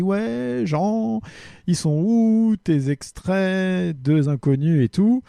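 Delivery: a playful imitation of an impatient listener; a teasing fan complaining about missing clips; mock listener. Mimics a listener's demand.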